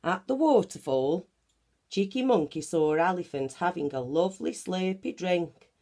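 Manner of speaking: plain reading voice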